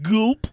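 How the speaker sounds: high, loud spoken gulp; exaggerated swallowing noise, pitch leaping up; cartoon gulp. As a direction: say a big comic gulp. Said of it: "Ray Liotta's like".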